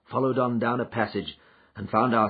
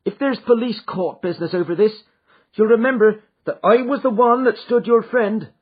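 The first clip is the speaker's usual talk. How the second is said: higher, louder servant woman; housekeeper, eager to tell; 10 semitones higher, 6 dB louder than usual. A narrator's reading. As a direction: press her loyalty earnestly. Housekeeper voiced.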